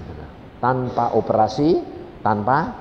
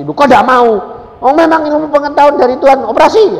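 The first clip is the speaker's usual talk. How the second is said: loud, high, fast urging; loud insistent preaching tone; loud persuasive; 14 dB louder than usual. Retold or imitated. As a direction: convince her surgery is from God.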